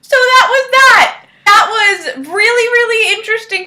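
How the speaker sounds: loud, high, slow exclaim; excited. Real, not acted. Vlogger back to camera after the skit, loud excited exclaim. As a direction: burst out with relief and glee. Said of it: "excited exclamation after the skit".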